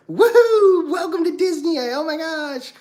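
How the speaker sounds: very high, whooping mock-cheerful voice; hammed-up cheery greeter. Upbeat cast member welcoming guests to Disney, exaggerated high cheer. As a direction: gush in a piercingly high theme-park welcome, stretching the sign-offs. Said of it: "People who are like".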